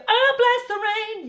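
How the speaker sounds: a hint of a dad belting it high; dad joining in. Teacher mimicking a dad singing along, lightly voiced. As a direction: sing it like a dad joining the party. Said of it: "your dad can do".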